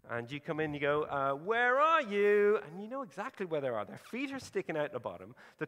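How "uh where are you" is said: loud, drawn-out mock search call to a hiding child; playful seeking call. Parent calling out to a niece hiding behind the curtain, loud and playful. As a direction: sing it out slowly, pretending not to see her. Parent playing hide and seek.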